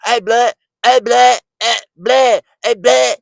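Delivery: mock the street accent, repeated like a bleat. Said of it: "You sound stupid when you talk like that".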